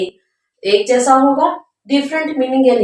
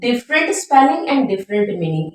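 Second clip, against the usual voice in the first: slow teacher dictation; much slower than usual. Shown as a demonstration. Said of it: "homophone का मतलब है".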